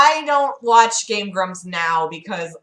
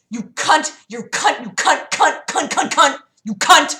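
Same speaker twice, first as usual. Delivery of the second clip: loud, high staccato volley of insults; sneezed-out insult; 6 semitones higher, 6 dB louder than usual. Retold or imitated. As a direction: imitate an abusive caller sneezing out the word. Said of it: "it was like he was sneezing".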